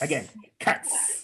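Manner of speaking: Cuts whispered as a snare hit; breathy snare cut. Shown as a demonstration. Coach demos the beatbox snare, voiceless Cuts. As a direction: spit out Cuts with no voice, a sharp K. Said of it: "everyone say it with me".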